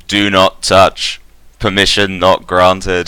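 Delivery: slow, loud robot warning; measured, mechanical refusal; monotone robot voice. Acted. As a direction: intone it like a security machine. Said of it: "monotone robot voice".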